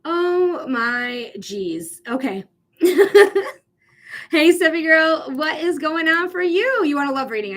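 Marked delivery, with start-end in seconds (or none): 2.8-3.5 burst of laughter. Real, not acted. Laughing at something in chat.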